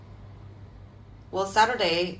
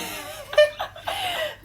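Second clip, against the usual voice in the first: high burst of laughter. Real, not acted. Laughs at deactivation.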